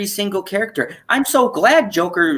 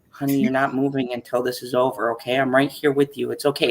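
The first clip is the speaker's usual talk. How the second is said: softer, lower, gentle coaxing; mock soothing wife; 6 semitones lower, 5 dB quieter, faster than usual. Wife calming her husband through the show, soft and lower. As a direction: talk him down kindly, lightly voiced.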